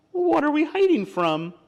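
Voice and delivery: high-pitched storybook critter question; nosy little animal voice; curious animal character. Acted. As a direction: ask it high and lively. Animal questions the hiding possum.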